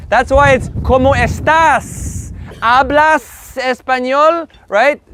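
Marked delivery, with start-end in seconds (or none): 0.8-4.5 slow, with the S of "estás" and "Hablas" held; stress on final S. Shown as a demonstration. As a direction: draw out the ending of "estás" and "Hablas". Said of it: "you always have an S".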